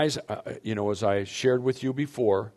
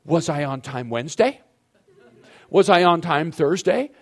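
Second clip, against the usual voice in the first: loud, higher, rising smug questions; defensive comeback; 7 semitones higher, 9 dB louder than usual. Shown as a demonstration. Husband defending himself to his wife, loud and smug. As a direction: throw the good days back at her. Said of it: "what a man will do that ticks you girls off".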